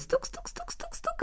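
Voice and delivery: very soft, high taps; hushed, clicky bird-peck noises; pecking sounds. A narrator's reading. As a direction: imitate tiny beaks pecking away. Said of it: "dalej żedziobać".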